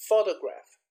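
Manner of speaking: "photograph" with the weight on "pho"; stress on first syllable. Shown as a demonstration. Teacher repeating the stress example, first-syllable stress. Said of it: "you can hear the stress in the first".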